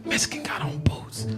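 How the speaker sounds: urgent whisper, passed along covertly; stage-whispered warning; hushed secret warning. Retold or imitated. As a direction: whisper it like a code phrase. Passing a secret prison warning.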